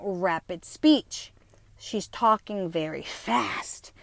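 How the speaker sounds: slow, measured mock assessment; mimicked clinician. Clinicians labelling her fast talk, slow mock diagnosis. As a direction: draw it out like a doctor noting symptoms.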